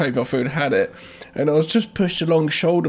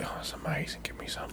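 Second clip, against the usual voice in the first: whispered begging dog; breathy whispered pleading pup; dog's voice; 3 semitones higher, 16 dB quieter than usual. Pretend. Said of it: "he's just like".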